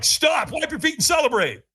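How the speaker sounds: high-pitched retold order; urgent call. Catchers greet firewalker at lane's end, acted urgent call. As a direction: call it out excitedly to someone arriving.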